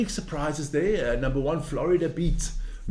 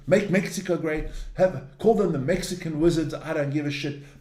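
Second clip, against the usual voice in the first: normal talking voice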